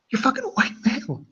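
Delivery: very high, soft put-on voice; squeaky outburst with the volume pulled down; hushed mock outrage. Repeats meme outburst, held back.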